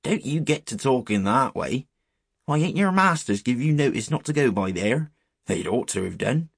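put-on old countryman warning youngsters off; shepherd's warning. Shepherd warning boys away from the well, rustic reproof.

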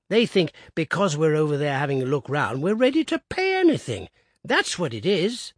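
put-on high, loud indignant old man; old Woodifield outraged at hotel prices; indignant piping. A narrator's reading. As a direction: huff over the price of jam. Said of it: "he piped".

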